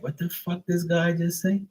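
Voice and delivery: high-pitched baffled question; baffled listener. Acted. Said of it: "where you're like".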